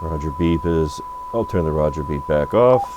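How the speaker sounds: ordinary talk, nothing marked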